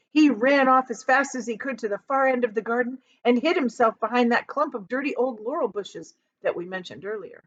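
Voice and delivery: usual speaking voice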